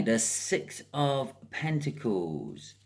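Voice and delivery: normal talking voice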